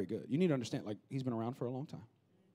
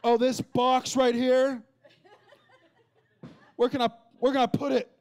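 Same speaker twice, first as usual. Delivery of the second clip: much higher, louder, slow showing off; mock macho show-off; hammed-up flexing guy; 11 semitones higher, 10 dB louder than usual. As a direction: strut like a guy flexing for a lady. Guys flexing to court lady.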